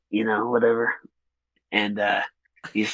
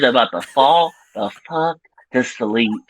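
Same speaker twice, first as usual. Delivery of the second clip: ordinary delivery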